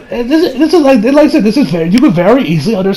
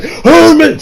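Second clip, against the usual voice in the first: high, punchy battle cry; acted attack call; 7 semitones higher than usual. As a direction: call out your special move. Joseph calling out his stand attack.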